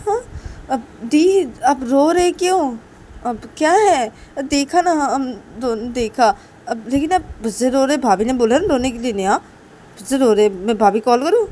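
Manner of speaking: acted indignant questioning; exasperated Rahul. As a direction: nag at full volume over the crying. Rahul scolds crying Soti.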